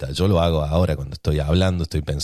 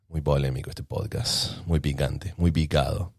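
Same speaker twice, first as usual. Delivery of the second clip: quiet aside; 4 semitones lower, 6 dB quieter, slower than usual. Real, not acted. Teasing aside about own podcast.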